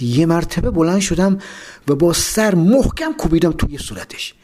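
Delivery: ordinary delivery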